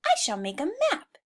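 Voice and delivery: a touch of excitement; eager idea. Acted. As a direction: blurt out the bright idea. Henry announces his plan.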